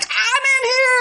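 put-on little girl calling from the laundry chute. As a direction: shout it to whoever just came in.

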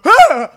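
short, high, loud yell; mock night-terror scream. Father waking with night terrors at three a.m., imitated scream. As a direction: imitate a sudden scream from sleep. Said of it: "my father would go".

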